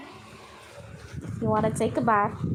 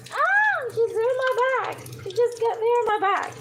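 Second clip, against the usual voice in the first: excited; 8 semitones higher, faster than usual. Real, not acted. Bird climbs onto her back.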